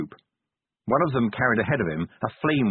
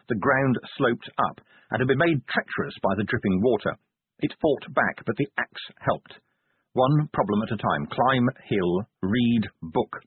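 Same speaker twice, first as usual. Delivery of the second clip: ordinary delivery, nothing marked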